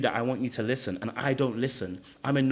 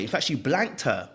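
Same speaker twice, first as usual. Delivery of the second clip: usual speaking voice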